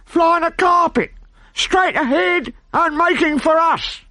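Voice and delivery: very high, slow call from the masthead; high, drawn-out sailor's call down; lookout calling down. A narrator's reading. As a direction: sing out from aloft.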